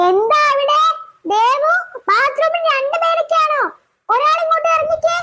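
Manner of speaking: put-on a mother checking on noisy kids; mother calling to daughters in the bathroom.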